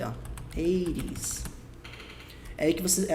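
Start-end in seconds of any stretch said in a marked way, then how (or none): 0.6-1.4 eighties said softly with a flapped t, like eiries; pronunciation demo. Shown as a demonstration. Teacher showing students how Eighties is read, softly. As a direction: model Eighties with a soft tapped middle. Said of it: "Como é que lê esse eighties aqui".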